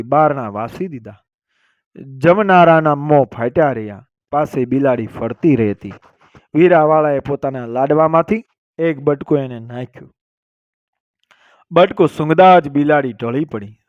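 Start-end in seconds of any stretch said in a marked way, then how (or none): none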